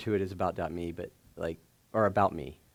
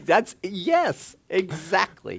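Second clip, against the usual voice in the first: laughing; 11 semitones higher, 7 dB louder, much slower than usual. Real, not acted. Laughing at own story.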